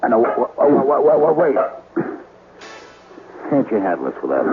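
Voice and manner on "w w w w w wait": frightened stammer. Acted. Frightened stammer in haunted cellar.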